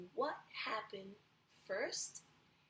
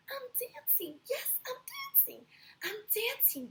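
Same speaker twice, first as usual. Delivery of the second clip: much higher, delighted and building; joyful cry; 13 semitones higher, 5 dB louder than usual. Acted. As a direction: burst out with pure joy. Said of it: "Gerald cried".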